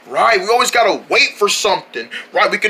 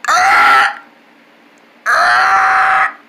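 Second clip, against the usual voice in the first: scream; 3 dB louder than usual. Acted. Screams in chaos.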